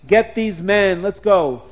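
loud command to attack; battle order. Acted. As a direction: bark the charge order.

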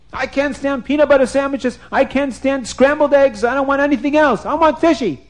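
high, whining royal tantrum; hammed-up food tantrum; petulant king. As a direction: complain like a spoiled ruler. King's tantrum over food.

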